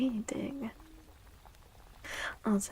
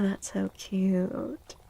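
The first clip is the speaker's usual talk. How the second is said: ordinary talk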